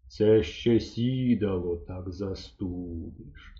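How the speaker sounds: slow, low grumble; a hint of a fussy hunter; lightly voiced hunter. A narrator's reading. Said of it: "сказав він". (мисливець відмовляється сісти, повільно й низько)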